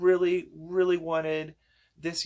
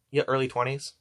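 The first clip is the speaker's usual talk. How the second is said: usual speaking voice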